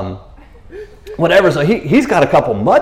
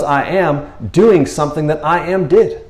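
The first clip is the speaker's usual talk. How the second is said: normal talking voice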